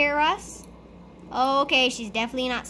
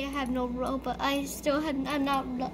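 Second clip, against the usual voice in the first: usual speaking voice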